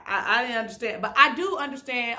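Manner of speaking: ordinary delivery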